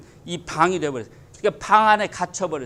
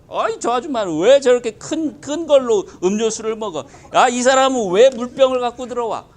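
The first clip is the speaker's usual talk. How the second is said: half-shouted, petty indignation; loud mock complaint; 5 semitones higher, 6 dB louder than usual. Congregant griping about others in church, mock indignant shout. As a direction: play the fussy pew neighbour, louder.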